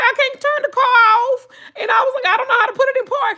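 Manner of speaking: high, fast frantic whine; panicked whine. Retold or imitated. Wife to husband on phone, high frantic whine. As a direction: wail it in a panic, words tumbling. Her own panicked quote.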